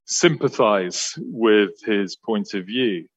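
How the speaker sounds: ordinary talk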